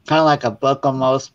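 ordinary talk

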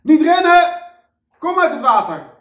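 very high, very loud shrieking orders; lifeguard screaming. Acted. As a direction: scream it across the whole pool. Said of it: "waar ze tegen iedereen kon schreeuwen".

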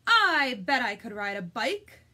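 loud, keen storybook duck; duck's bold thought. Acted. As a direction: voice the duck's big idea out loud.

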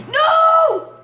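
sudden high shout of dismay; being chased. Real, not acted. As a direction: yell no in panic. Horse runs off.

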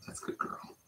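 soft unvoiced whisper to a pup; hushed whisper of praise. Real, not acted. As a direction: breathe it gently.

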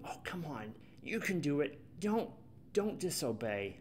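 soft, hushed urging under the breath; quietly will the child not to do it; hushed pleading. Retold or imitated. Parent quietly pleading with watched child.

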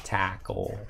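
low mock-Canadian "Tackle"; mock Canadian accent. Shown as a demonstration. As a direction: repeat "Tackle" quietly as a pretend Canadian. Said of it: "That's how they say it in Canada".

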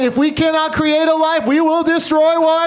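high, mock-menacing villain vow; high mock-villain declaration; sperm villain voice. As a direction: ham up a doomed army's vow. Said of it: "They were saying".

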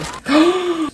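high delighted gasp; mock gasp. Pretend nominee hearing news, mock gasp.

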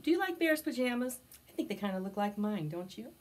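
usual speaking voice

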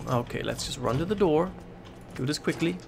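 ordinary talk

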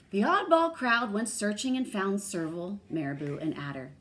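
loud, lively storybook delivery; expressive read-aloud, projected; animated story reading. A narrator's reading. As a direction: read a character's line to children with big swings.

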